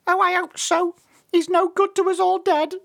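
squeaky, very high chick grumble; tiny high voice, put out; tinier annoyed voice. A narrator's reading. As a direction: complain in a teeny bird voice. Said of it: "came another tinier voice".

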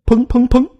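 high, loud bang-bang-bang; spoken knocking sound effect; imitated door knocking. A narrator's reading. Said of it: "门外再次敲门声".

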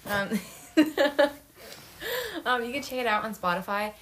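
usual speaking voice

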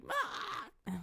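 high screeching call; pterodactyl shriek. Retold or imitated. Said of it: "sounds like a pterodactyl".

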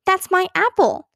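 high-pitched protest, no real shouting; a narrator's restrained child scream; mild mock scream. A narrator's reading. Little sister whose apple was snatched, mild mock scream. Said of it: "screamed Ramona".

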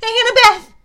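high, loud anguished cry; Percy crying out as Annabeth vanishes; desperate call. A narrator's reading. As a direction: call her name as she falls. Percy calls after falling friend.

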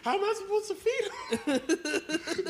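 baffled whine. Retold or imitated. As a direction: whine it helplessly. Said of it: "mock confused car owner".

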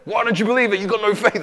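high, loud, hammed-up accusing voice; bully them about their faith, overdone; shrill caricature of a pushy believer; mock pushy preacher. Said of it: "Don't be like".

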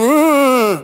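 put-on a whine like a sulking child; a hammed-up sulky groan; mock whiny groan. Mimicking a sigh of complaint.

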